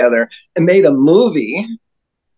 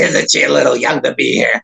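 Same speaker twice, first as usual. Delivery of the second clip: put-on character voice, slightly lowered; Bitchy; 3 semitones lower than usual. Bitchy teasing the old actor about his companion, in character voice. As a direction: needle him in a put-on comic character voice. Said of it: "in Bitchy's voice".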